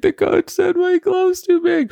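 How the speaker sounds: put-on very high, slow, weepy little-kid voice; imitating a bawling child. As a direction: whimper it like a heartbroken four-year-old. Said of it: "came over to me bawling".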